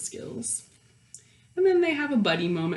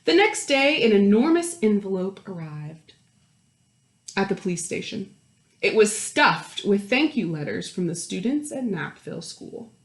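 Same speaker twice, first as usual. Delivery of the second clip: put-on lively read-aloud voice for children; animated picture-book narrator; storybook narration; 6 dB louder than usual. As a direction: read it like story time to a class.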